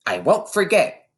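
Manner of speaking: put-on high young girl voice; eager little girl promising; girl's voice. A narrator's reading. A schoolgirl promising her teacher, high and eager. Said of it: "Lavender said".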